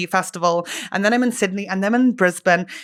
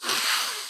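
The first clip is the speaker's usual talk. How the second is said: long forceful puff of breath; noisy exhaled gust; hard blowing; 9 dB quieter than usual. Retold or imitated. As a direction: blow out as hard as possible. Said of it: "blowing as hard as I could".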